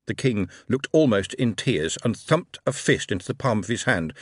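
ordinary delivery, nothing marked

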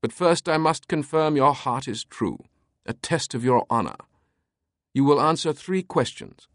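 high, grand British voice; theatrical British eccentric; British proclamation. A narrator's reading. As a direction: proclaim it with a thick British accent. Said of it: "Teabing proclaimed".

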